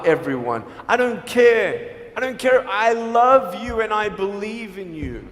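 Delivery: usual speaking voice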